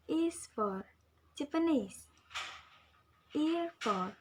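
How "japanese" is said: "Japanese" with final NESE emphasized; stress on the suffix. Shown as a demonstration. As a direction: lean on the last syllable of "Japanese", then fall. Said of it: "the following suffixes need to be imposed".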